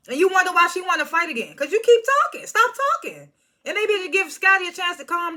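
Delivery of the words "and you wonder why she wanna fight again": ordinary delivery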